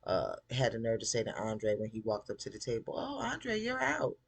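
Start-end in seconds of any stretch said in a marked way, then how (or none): 3.0-4.2 high, smirking singsong; smug dismissal. Retold or imitated. Gideon smugly firing Andre, high and smirking. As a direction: sneer it sweetly, pitched high. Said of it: "Punk ass smiling".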